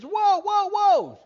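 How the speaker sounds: high, loud repeated cries; panicked yelling. Acted. Man on runaway horse nearing cliff, panicked yelling. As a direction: yell frantically to halt the horse.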